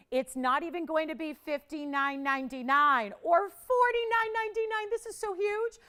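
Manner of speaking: host hyping the sale price to callers; excited price reveal. Real, not acted. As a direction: build up the price drop like big news. Host announces price drop excitedly.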